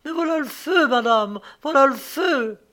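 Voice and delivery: high, loud warning of fire; acted frantic alarm; alarmed cry. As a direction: cry out in panic, pitch jumping up. Maid cries out as fire spreads.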